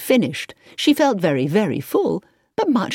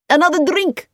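high, loud offer; demanding; 12 semitones higher, 5 dB louder than usual. A narrator's reading. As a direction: press another round on them loudly. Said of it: "Marcus demanded".

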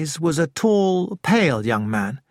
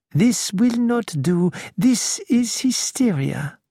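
slow, higher, a brisk cheerful detective; jolly her out of it, slowly; cheerful Poirot; 7 semitones higher, slower than usual. Acted. Poirot briskly cheering up Nick, slow and higher. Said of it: "Poirot's voice was cheerful".

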